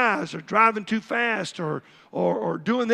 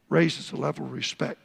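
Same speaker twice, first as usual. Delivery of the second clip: normal talking voice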